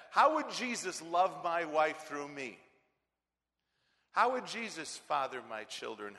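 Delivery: loud, rising rhetorical questions; preaching at full force. Acted. Preacher pressing the congregation with loud questions. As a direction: hammer each question home.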